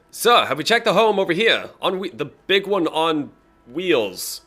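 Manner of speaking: high, loud guard reporting in; shouting guard. Acted. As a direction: call out to a superior, high and loud. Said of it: "then you hear a voice go".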